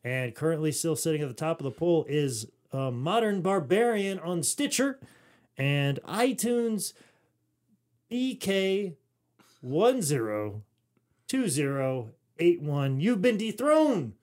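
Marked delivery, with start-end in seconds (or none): 2.9-14.1 slow, drawn-out proclamation; announcer voice. Pretend. Host proclaims the new top reviewer, slow announcer style. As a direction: announce each name like a title change.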